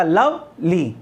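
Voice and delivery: slow "lovely" broken as लव, ली; split into parts. Shown as a demonstration. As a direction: pause inside "lovely" after लव. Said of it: "एक part हो गया लव".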